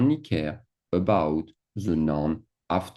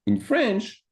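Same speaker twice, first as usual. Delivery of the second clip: "In French" pitched much higher; emphatic contrast. Shown as a demonstration. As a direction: lift "In French" high to mark the contrast. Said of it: "contrasting French and English".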